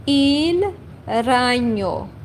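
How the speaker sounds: "il ragno" drawn out, with gn as ny; careful, slow "il ragno"; slow Italian demo. Shown as a demonstration. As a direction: say "il ragno" slowly and clearly. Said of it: "I say".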